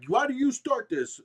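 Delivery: high, slow mock-accusing teacher; mock teacher scolding. Teacher blaming the kid, mocked high and slow. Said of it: "they're like".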